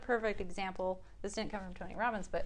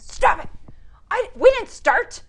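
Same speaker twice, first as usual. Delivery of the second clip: furious shout, much higher and louder; retold shout; 11 semitones higher, 16 dB louder than usual. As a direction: yell it like a huge angry man. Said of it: "He says".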